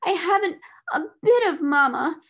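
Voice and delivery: put-on high, loud little-girl lament; proclaimed wail. A narrator's reading. Motherless child insists on her woe, proclaimed wail. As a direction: wail it out for all to hear. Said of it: "she proclaimed".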